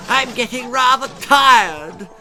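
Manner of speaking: high, loud posh whine; complaining. A narrator's reading. Lady Davinia complaining to her husband mid-hunt. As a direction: moan about being tired. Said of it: "complained Lady Davinia".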